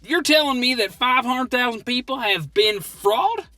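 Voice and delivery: normal talking voice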